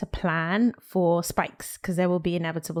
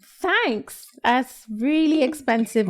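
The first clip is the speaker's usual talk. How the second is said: high, slow mock thanks; mock gratitude; 6 semitones higher, 3 dB louder, much slower than usual. Guest reacting to her back-tax bill, high mock gratitude. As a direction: pitch it way up, drawn out and hammed up.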